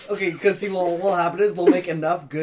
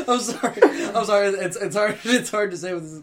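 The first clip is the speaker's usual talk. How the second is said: higher, breaking up with laughter; real giggling; 5 semitones higher, 4 dB louder than usual. Real, not acted. Storyteller to friends, losing it mid-retelling, laughing. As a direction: apologize while failing to keep a straight face. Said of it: "with a straight face".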